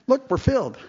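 high, strained show of happiness; forced cheer. Retold or imitated. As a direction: fake a bright smile in your voice. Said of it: "trying real hard".

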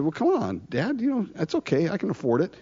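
casual mock persuasion of a father; coaxing son. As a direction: softly wheedle Dad, pitch lifted. Absalom coaxing his father, acted.

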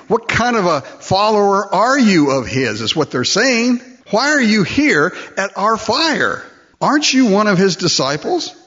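high, loud accusing taunt; hammed-up servants calling Peter out. Preacher voicing the servants accusing Peter, high taunting voice.

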